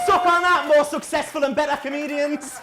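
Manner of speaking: triumphant shout. Real, not acted. Comic gloating at rival comedians after the flag, shouting. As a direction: roar it like a winning goal.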